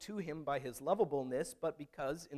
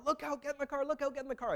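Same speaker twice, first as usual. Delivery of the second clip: much higher urgent warning cry; sister yelling; 12 semitones higher, faster than usual. Retold or imitated. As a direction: warn him to get back in the car now. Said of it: "my sister's yelling from the car".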